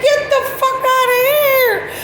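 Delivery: high wailing through laughter; real tearful laughing; laugh-crying. Real, not acted. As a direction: squeal it out while crying with laughter. Quote through heavy laughter.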